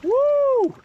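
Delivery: loud, high joyful whoop; excited whoop. Real, not acted. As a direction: let out a thrilled shout. Whooping while bathing in river.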